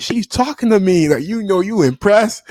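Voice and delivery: showing off to his rival; imitating kid bragging.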